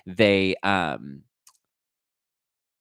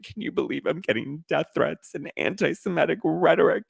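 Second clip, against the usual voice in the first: higher, affected fake-outraged voice; mock online victim; 8 semitones higher than usual. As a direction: parody a star playing the victim online. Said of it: "they're just like".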